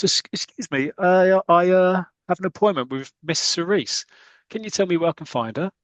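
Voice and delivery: high, halting, flustered voice; nervous Blackie. Retold or imitated. Blackie nervously asks stranger for Miss Cerise, high and halting. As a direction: stammer it out like a shy suitor. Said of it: "Blackie goes up to him and goes".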